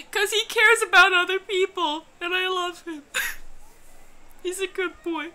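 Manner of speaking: high, squeaky gushing through laughter; high-pitched giggly fondness; laughing adoration. Real, not acted. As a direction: gush fondly, fading into a giggly squeak. Gushing over the character while laughing.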